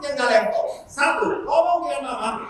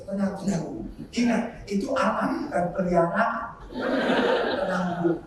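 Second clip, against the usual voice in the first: calming; 6 semitones lower, 4 dB quieter than usual. Retold or imitated. Priest calming an angry mother.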